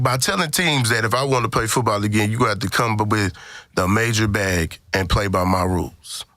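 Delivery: usual speaking voice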